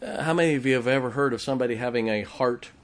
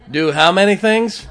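louder call; 5 semitones higher, 7 dB louder than usual. Real, not acted. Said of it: "You sound like Methodists now".

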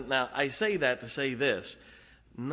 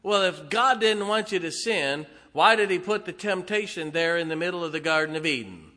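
higher, louder mocking objection; imitating a challenger, pitched up and pushed; mock liberal challenge; 4 semitones higher, 5 dB louder than usual. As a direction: put on a doubter's indignant question. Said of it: "I know the liberals will tell you".

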